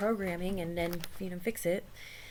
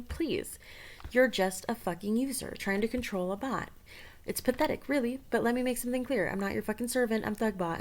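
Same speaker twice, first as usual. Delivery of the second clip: rude chatbot; 9 dB louder than usual. Acted.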